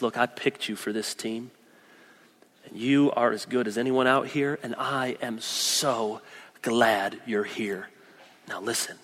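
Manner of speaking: gentle coach. Retold or imitated. Coach pulls player aside kindly.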